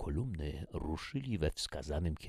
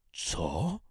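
louder, startled outburst; raised voice; 3 semitones higher, 5 dB louder than usual. A narrator's reading. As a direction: blurt it out in disbelief. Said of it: "Podniesiony ton głosu majora". (podniesionym głosem, z niedowierzaniem)

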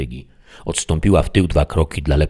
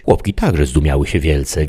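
ordinary delivery

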